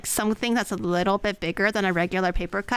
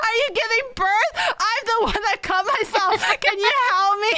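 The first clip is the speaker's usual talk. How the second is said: much higher, loud incredulous shout; exasperated yell; 11 semitones higher, 3 dB louder than usual. Retold or imitated. Sister bleeding, yelling at fainting brother: exasperated shout. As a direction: yell at him in disbelief. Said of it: "He's like".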